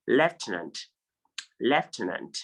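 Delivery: lieutenant as LEF-tuh-nant; correct pronunciation. Shown as a demonstration. Teacher correcting students, lieutenant as LEF-tuh-nant. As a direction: clear F before the T in lieutenant. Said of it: "but it's actually".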